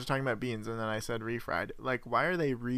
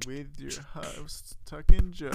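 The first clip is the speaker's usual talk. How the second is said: announcer; 3 semitones higher, 9 dB louder than usual. Acted. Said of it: "announcing the podcast hosts".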